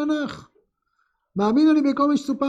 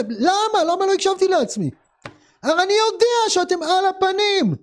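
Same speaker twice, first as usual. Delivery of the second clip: self-reproaching Kuzari; 6 semitones higher than usual. Acted. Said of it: "אומר".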